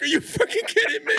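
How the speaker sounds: very high, fast, laughing outrage; disbelief. Retold or imitated. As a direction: squeal it in mock exasperation. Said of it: "I'm like".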